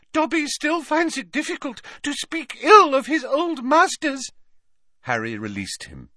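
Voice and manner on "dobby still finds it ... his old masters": very high, effortful elf voice; panting squeaky elf. A narrator's reading. Elf recovering from a struggle, panting out a high-pitched confession. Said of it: "he panted".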